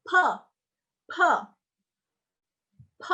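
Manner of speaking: P with an audible burst of air; aspirated P. Shown as a demonstration. Teacher repeating ada aspirasinya, breathy burst on P. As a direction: puff out each isolated P. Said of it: "Ada aspirasinya".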